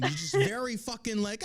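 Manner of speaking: ordinary talk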